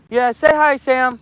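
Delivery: high coaxing voice to a puppy; coaxing the dog. Real, not acted. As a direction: sweet-talk the dog to say hi.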